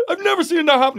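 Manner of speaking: high-pitched mock disbelief; imitated amazement. Other air host hearing about the stuck earbud, mock-amazed. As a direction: exclaim it in comic astonishment.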